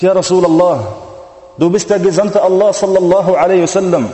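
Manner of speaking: high, loud tearful plea, slowing on the kings; fervent pleading preaching. Acted. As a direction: plead with the Prophet at full force. Umar pleads weeping to Prophet.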